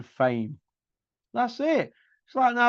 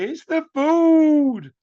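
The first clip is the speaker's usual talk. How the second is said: high, loud, very slow mock whine on "It's the food"; mocking Hatton excuse; 11 semitones higher, 7 dB louder, much slower than usual. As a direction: stretch the excuse out like a sulky child. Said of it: "coming out with".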